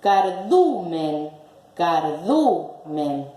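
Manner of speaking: slow, split "Cardumen", du stressed. Shown as a demonstration. Teacher presenting an example, "Cardumen" stressed on du. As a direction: break "Cardumen" into car, DU, men.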